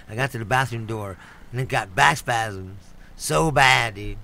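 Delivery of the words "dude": ordinary talk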